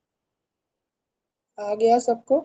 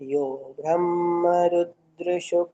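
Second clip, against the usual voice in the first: the whole compound in one slow flowing phrase; read together slowly; much slower than usual. Shown as a demonstration. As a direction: join the pieces into one slow line. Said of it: "अब एक संग पढ़ेंगे".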